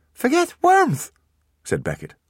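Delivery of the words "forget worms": put-on slow, high horrified little-boy cry; a child aghast at losing worms; horrified toddler. Beckett is horrified, slow high child voice.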